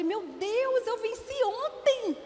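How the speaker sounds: high, slowed wail of disbelief; dismayed irony. Retold or imitated. Mother hearing her son forgot his homework, high mock despair.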